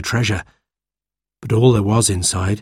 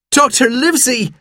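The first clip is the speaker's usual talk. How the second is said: high, overjoyed call; crying out. A narrator's reading. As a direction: hail your rescuer. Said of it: "I cried".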